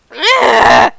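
short, very loud wordless scream; loud yell. Real, not acted. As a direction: let out a sudden loud yell. Startled cry during game.

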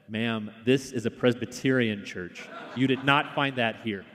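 normal talking voice

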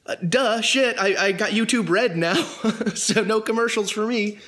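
high, goofy self-mocking voice; mock dopey self. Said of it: "And I was like".

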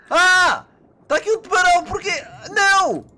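high, loud panicked shouting; panicked scream. Real, not acted. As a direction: yell in real panic. Attacked by a shark.

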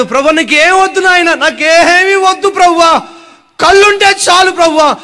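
high, loud tearful begging; crying plea. Acted. Blind man crying to Jesus, high tearful plea. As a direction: cry out to the Lord. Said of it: "అని వాడు ఏడుస్తుంటే".

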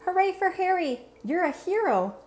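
high excited cheer. Acted. Fans cheering Harry after the game, excited. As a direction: cheer him on with delight.

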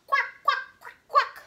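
high, loud cartoon duck; loud duck quacking. Teacher showing children what the noisy duck does, loud high quacking. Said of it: "because Dante does".

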